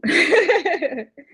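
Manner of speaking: high quick laugh. Real, not acted. Buyer laughing while talking about her lender. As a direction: laugh mid-thought.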